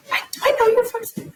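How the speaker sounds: high, fast, breathless recognition; excited customer. Retold or imitated. Customer recognizing her from the show, high and fast excited. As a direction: squeal it like a starstruck fan.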